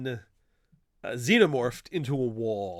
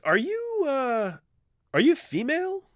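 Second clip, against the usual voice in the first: pitched way up, hesitant and disbelieving; squeaky mock-awkward Batman; high incredulous question; 4 dB louder than usual. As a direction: ask it in a high, halting voice, hardly believing. Batman voiced in retold scene.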